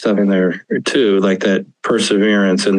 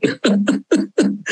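high laugh; 13 semitones higher, 3 dB quieter than usual. Real, not acted. Laughs at own joke.

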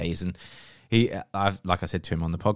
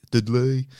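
higher, louder mock Brummie accent; 5 semitones higher, 5 dB louder than usual. Shown as a demonstration. Co-host playfully naming the storm in a mock Brummie accent. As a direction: put on a bad, hammed-up Brummie accent. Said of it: "I wanna say it in the accent".

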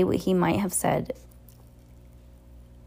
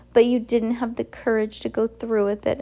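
higher-pitched, lightly mocking taunt; 5 semitones higher than usual. A narrator's reading. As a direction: taunt them with a mocking smile. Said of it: "mocking, with a mocking smile".

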